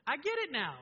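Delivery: sudden joy of understanding; son's realization. Retold or imitated. Son to his dad after the drawing, excited. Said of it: "He goes".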